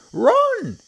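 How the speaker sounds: very high, loud monkey shriek; high-pitched alarm cry; urgent shout. A narrator's reading. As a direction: scream a warning to the children. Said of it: "Mugglewump shouted to the children".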